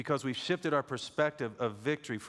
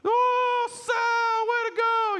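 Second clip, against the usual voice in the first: much higher, much louder cheering yell; Kenny cheering; 10 dB louder, much slower than usual. Retold or imitated. Cousin Kenny cheering Sal after a sale, ecstatic yell. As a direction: whoop it like a loud cheerleader cousin. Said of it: "You'd hear, hear Kenny go".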